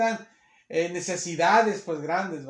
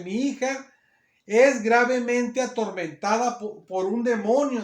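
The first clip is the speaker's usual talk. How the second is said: loud, raised-pitch plea; raised pleading; 4 semitones higher, 6 dB louder, slower than usual. Acted. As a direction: plead insistently for your daughter. Voicing the mother's plea.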